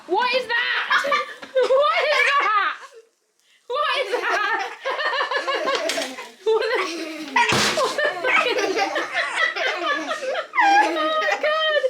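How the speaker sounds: high, slow words through laughter; cracking up at the ruined pizza; laughing. Real, not acted.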